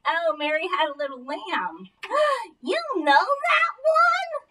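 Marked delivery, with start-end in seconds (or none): 1.9-2.6 sharp high gasp of amazement; surprised gasp. Acted. Ventriloquist feigns amazement. 2.6-4.4 high, slow astonished question; mock amazement. Ventriloquist feigns amazement.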